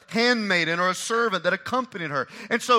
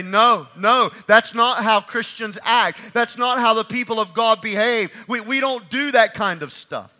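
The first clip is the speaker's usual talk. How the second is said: loud, slow, emphatic declaration; preaching at full force; 4 semitones higher, 7 dB louder than usual. Retold or imitated. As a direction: proclaim it with conviction. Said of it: "speak up and say".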